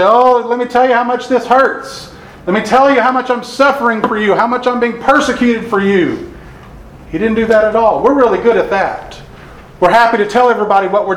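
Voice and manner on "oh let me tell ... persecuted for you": high, hammed-up complaining whine; mock whining. Preacher mocking complainers for the congregation, high mock whine. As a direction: whine it like a self-pitying martyr, pitched up. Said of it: "he didn't whine and complain".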